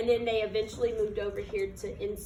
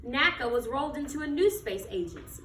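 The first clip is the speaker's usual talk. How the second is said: put-on reading to children; 4 semitones higher than usual. Teacher reading a picture book to her class, storytelling voice. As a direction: read it like storytime on the rug.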